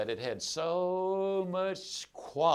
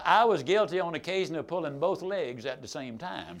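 ordinary delivery

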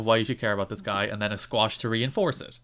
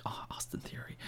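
whisper; 19 dB quieter than usual. Retold or imitated. Zoning out while watching.

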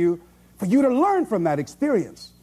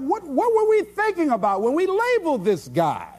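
bewildered; 9 semitones higher than usual. Retold or imitated. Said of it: "they have to ask themselves".

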